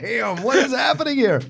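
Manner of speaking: high-pitched, amused disbelief; mock shock. Reacting to Joe's brick-throwing tweet, mock shock. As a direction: exclaim it high, in playful alarm.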